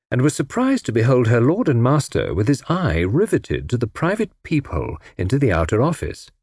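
plain reading voice

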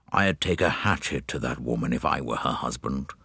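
put-on low, soft, hoarse old man; hoarse, quavering. A narrator's reading. Old passenger behind Poirot, hoarse quavering grumble. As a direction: quaver it out like an aged gentleman. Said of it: "A hoarse, quavering voice behind him".

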